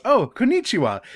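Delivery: put-on cheery, high American-accented greeting; American-accented Japanese. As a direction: imitate a friendly American jogger attempting Japanese. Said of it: "in the most American possible accent".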